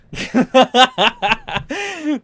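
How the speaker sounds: high, loud burst of laughter; real high-pitched cackling laugh; loud cackle. Real, not acted. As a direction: crack up at your own joke. Cracks up at own remark.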